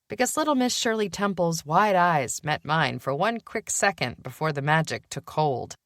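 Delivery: usual speaking voice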